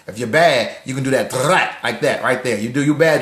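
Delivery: ordinary talk, nothing marked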